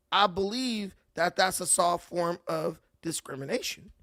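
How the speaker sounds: usual speaking voice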